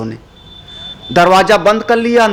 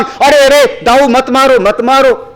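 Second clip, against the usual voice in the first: high, raised cry of intervention; urgent plea to stop; 8 semitones higher, 3 dB louder than usual. Acted. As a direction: call out urgently to stop your brother. God runs up to stop brother. (ऊँचे स्वर में घबराकर रोकने की पुकार)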